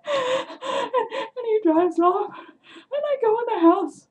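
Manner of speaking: high, squeaky laugh-talk; cracking up while telling it. Real, not acted. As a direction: talk through helpless laughter. Cracking up at her story.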